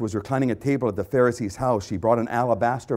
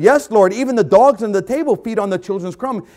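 loud, high, insistent pleading; raised-voice begging; urgent plea; 10 semitones higher, 9 dB louder than usual. Acted. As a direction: press the plea upward, building to a cry. Acting the woman's plea to Jesus.

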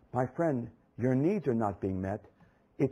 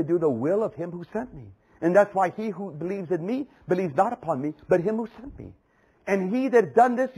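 higher, building preacher voice as Jesus; impassioned preaching; 9 semitones higher, 4 dB louder than usual. Acted. Preacher voicing Jesus's words to the church, rising and intense. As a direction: lift the voice and press each claim.

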